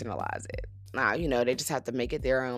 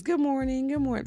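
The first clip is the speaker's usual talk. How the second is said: sing-song greeting; 10 semitones higher than usual. Retold or imitated. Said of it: "New York comes down and says".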